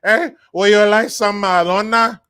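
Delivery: loud, high mock Mexican accent; imitated Mexican accent. Migrant offering a friend McDonald's, imitated Mexican accent. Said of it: "that's how they talk".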